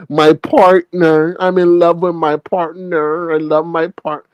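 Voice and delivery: slow, drawn-out mock baby talk; little kid voice. Host mocking him being in love with partner, baby voice. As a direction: mimic a lovesick little kid, stretching the words. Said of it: "like a little baby, like a little kid".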